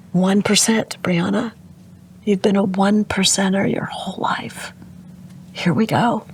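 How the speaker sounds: mother whispering comfort to her unconscious daughter. Retold or imitated. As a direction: whisper it close, gently, like at a bedside. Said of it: "I just whispered in her ear".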